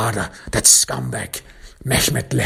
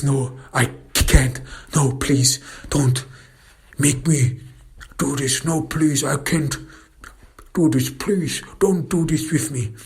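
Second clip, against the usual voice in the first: higher, whimpering imitation of begging; pleading culprit; 5 semitones higher than usual. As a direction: beg in a high, desperate acted voice. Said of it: "And then he says".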